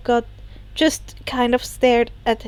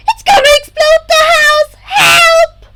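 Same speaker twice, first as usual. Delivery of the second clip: much higher and louder, frantic; panicked cry for help; 10 dB louder than usual. Acted. Himiko begging friends to save the house, panicked shout. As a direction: yell for help like the house is about to blow. Creeper about to blow up house.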